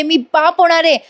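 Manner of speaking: acted cry of joy from a father-to-be; mock excited shouting. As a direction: proclaim it with glee, without real volume. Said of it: "असं म्हणून मोठ्या मोठ्याने ओरडायला लागतो".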